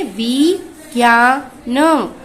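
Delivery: very slow, drawn-out विज्ञान; slow drawn-out demo. Shown as a demonstration. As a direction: stretch विज्ञान for children to repeat. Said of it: "बोलिए". (विज्ञान को धीरे-धीरे खींचकर)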